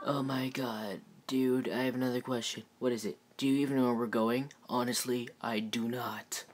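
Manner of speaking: hushed, worn-out voice; sigh, then speak very softly; weary murmur. Acted. Deadpool worn out after two hours walking, weary and hushed.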